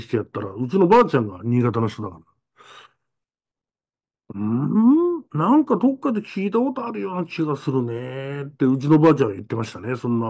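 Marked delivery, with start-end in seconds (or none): 4.3-8.4 声色を変えた祖母の思案; musing old woman voice; old grandma. Retold or imitated. (昔を思い出す祖母を、声色を変えて)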